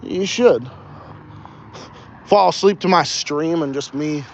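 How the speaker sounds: ordinary talk, nothing marked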